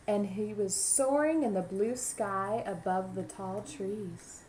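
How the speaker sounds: lively picture-book narration; storybook voice for young listeners; reading to children. A narrator's reading. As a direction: read it aloud to a class of kids.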